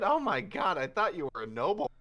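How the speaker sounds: high, loud, fast gush; excited realization. Acted. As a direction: blurt it out thrilled. Inventor realizes she's a noble.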